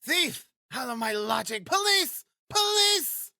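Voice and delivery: very high-pitched shouted alarm; outraged high shriek; landlady yelling. A narrator's reading. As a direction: yell for help in a screeching landlady voice. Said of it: "yelled Amalia Ivanovna".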